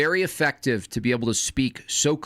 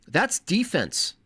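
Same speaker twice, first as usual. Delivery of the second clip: normal talking voice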